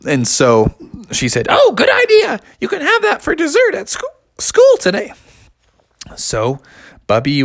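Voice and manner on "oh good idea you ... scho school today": cheery mom voice. Acted. Mother waking Bubby for school, very high and chirpy. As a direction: wake a child like a chirpy mom.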